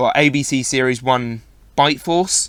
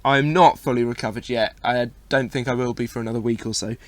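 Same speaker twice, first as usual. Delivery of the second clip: ordinary delivery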